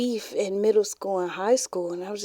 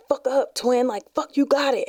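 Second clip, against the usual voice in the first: higher-pitched egging-on from across the bus; imitated cheering voice; 6 semitones higher than usual. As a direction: mimic a twin urging her on. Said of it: "all I heard was this voice".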